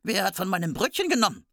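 high, fast little dwarf; quick indignant dwarf question. A narrator's reading. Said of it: "Der dritte".

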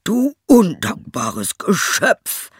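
high, slow, outraged shout; furious cry. Acted. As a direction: curse the ungrateful beast. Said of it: "rief er".

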